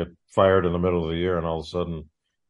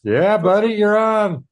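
excited; 12 semitones higher, 6 dB louder, slower than usual. Real, not acted. Greeting caller, calling out.

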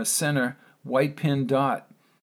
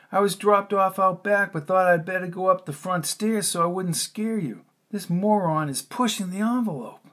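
a hint of a tense man, a little higher; husband telling his wife why he came in the front, slightly raised; lightly voiced Ben; 6 semitones higher than usual. A narrator's reading. Ben explaining to Caroline, lightly voiced and tense.

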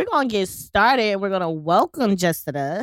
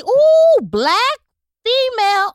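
high excited ooh; excited squeal; 9 dB louder, slower than usual. Real, not acted. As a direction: squeal with delight. Excited cheer.

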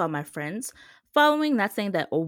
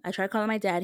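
ordinary talk

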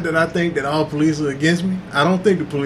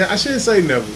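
ordinary delivery

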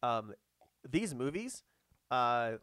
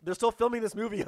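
high, loud and pressed; harried boss; 8 semitones higher, 6 dB louder than usual. Acted. Boss hurrying a writer while filming goes on, high and urgent. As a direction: push the writer with deadline panic.

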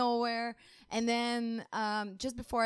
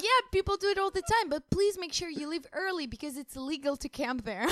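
imitating a friendly local; 7 semitones higher, 6 dB louder than usual. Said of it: "They're like".

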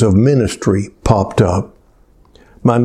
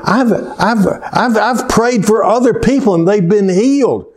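griping at God; 6 semitones higher than usual. Retold or imitated. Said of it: "I remember griping at God".

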